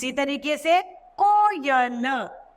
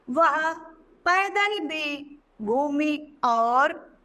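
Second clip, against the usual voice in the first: slow, careful classroom read; 2 semitones higher, much slower than usual. Shown as a demonstration. Teacher reads example sentence.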